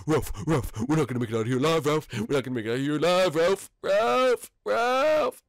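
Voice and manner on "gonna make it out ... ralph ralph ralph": panicked cry. Real, not acted. Escaping with Ralph, crying out.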